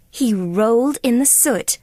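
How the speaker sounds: ordinary delivery, nothing marked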